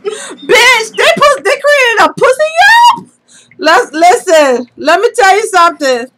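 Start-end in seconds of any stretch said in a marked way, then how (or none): 0.0-3.1 high-pitched disbelief; incredulous outburst. Real, not acted. Friend hearing of the new review account, incredulous. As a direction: echo the news back, astonished. Reacts to startling news.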